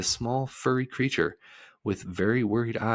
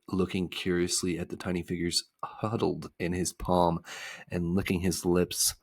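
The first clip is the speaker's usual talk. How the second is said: ordinary delivery, nothing marked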